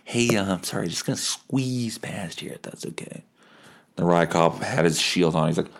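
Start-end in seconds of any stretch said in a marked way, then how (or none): 0.0-3.2 soft, tentative polite request; trying charming. Retold or imitated. Protester asking riot cops to let him through, soft charm. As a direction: sweet-talk softly to slip past. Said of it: "like, charming Tyler".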